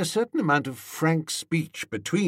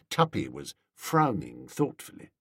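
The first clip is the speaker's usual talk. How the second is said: plain reading voice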